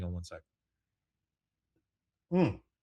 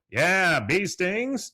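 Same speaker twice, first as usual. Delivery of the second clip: Dale voice; 9 semitones higher, 5 dB louder, much slower than usual. Retold or imitated.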